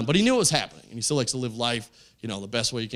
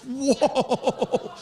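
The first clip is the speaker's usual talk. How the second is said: high, drawn-out shocked whoa; taken aback; surprised; 11 semitones higher than usual. Retold or imitated. Comic reacts to a homophobic insult with a high startled whoa. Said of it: "And I was like".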